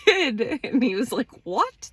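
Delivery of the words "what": high, startled imitation of disbelief; flabbergasted. Partner shocked at how she took it, flabbergasted. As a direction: jump up in pitch, taken aback. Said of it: "flabbergasted that I took it".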